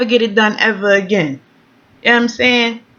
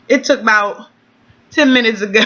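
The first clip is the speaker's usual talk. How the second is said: usual speaking voice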